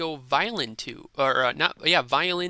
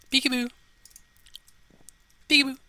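playful peekaboo; 8 semitones higher, faster than usual. Acted. Playful peekaboo at other player.

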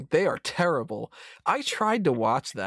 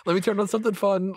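higher, hurried playful no no no; a hammed-up, much higher nope, fast; mock refusal; 9 semitones higher, faster than usual. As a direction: wave off the sad movie in a jokey panic. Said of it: "I'm just like".

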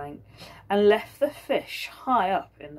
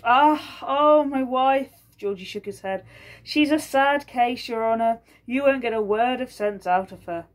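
Georgie's sighing excuse; 6 semitones higher, 6 dB louder than usual. Acted. Georgie dismissing his wife.

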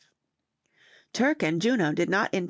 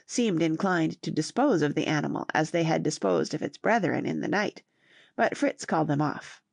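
plain reading voice

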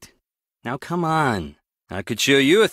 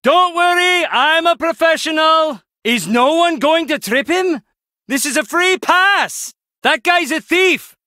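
loud, very high-pitched calling out; urgent shouting; 13 semitones higher, 4 dB louder than usual. Acted. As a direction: shout it across a chase, voice way up. Shouting during chase of a thief.

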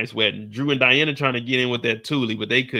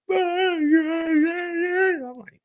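very high, long mock wail; imitated bawling, pitched way up; hammed-up high sobbing noise; mock crying. Mimics woman crying on him.

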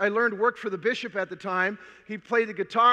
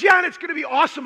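fast, louder, higher eager gush; excited sister; 5 semitones higher, 5 dB louder, faster than usual. Retold or imitated. Sister urging her brother onto the bus, fast excited gush. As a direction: rush it out like a thrilled sister selling the trip. Sister hyping the Notre Dame trip.

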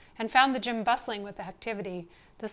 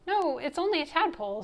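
higher-pitched, earnest correction; lightly voiced boy; 6 semitones higher, 4 dB louder than usual. A narrator's reading. Louis explains his pet to the coach, higher and earnest.

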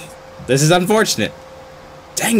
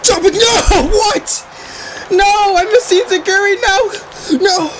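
shocked yelp; 12 semitones higher than usual. Real, not acted. Gamer yelping at the wrestling game in shock. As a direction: yelp in disbelief as the move lands.

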